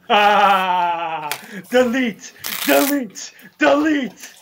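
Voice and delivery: high, repeated mock delete chant; mock deleting robot. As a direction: call each delete high, like a catchphrase. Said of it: "I could be just like".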